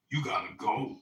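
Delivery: loud flat order, far from his own voice; mock bouncer command; firm bouncer. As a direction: tell him to leave, no discussion.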